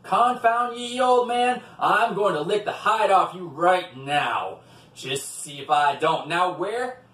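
loud, furious devil storming; foaming mad. A narrator's reading. Old Scratch fighting Wicked John, furious threat. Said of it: "foaming at the mouth mad".

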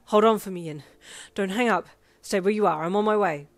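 loud, firm, breathless instructions; pressing, protective urgency; urgent reassurance. Acted. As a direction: keep them on the line while running. Running rescuer instructs caller.

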